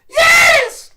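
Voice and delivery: very high, loud mock-ecstasy yell; ecstatic scream. Bobby answering Kim in bed, hammed-up high scream. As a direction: shriek it like an overwhelmed lover.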